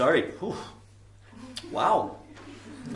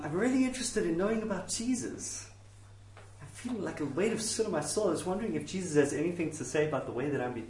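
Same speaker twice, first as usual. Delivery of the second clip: soft, hesitant inquirer; quiet, tentative questioning; earnest seeker; 6 dB quieter than usual. Acted. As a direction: ask a minister shyly and sincerely. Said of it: "It goes something like this".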